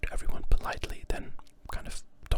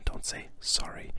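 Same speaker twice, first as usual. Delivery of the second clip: ordinary delivery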